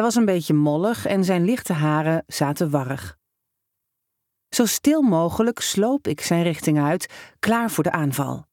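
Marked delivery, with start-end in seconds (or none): none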